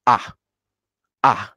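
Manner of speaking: short, sharp falling "ah"; angry tone four. Shown as a demonstration. Teacher gives an example of tone four, angry falling "ah". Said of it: "call it the angry tone".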